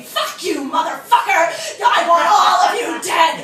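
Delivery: teacher raging at his actors over Edward's death; furious screaming rant. Retold or imitated. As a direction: scream at the whole class.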